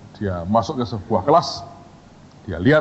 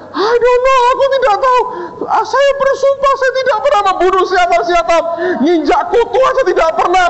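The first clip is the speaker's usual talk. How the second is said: very high frantic squeal; comic wailing student pleading with the boss; mock tearful panic; 4 dB louder than usual. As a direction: swear innocence in hammed-up desperation.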